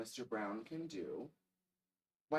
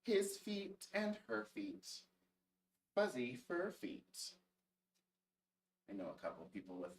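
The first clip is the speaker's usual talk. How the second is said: put-on reading to children; 3 semitones lower than usual. A narrator's reading. Reads a picture book aloud.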